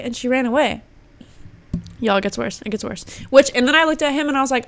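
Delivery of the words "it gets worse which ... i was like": ordinary delivery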